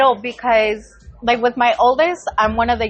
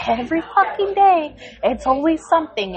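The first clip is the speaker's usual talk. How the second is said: higher-pitched mock outrage; retold exasperated rant; 5 semitones higher than usual. Mom ranting to son about his teacher, mock exasperated. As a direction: replay a fed-up parent's complaint, pitched up.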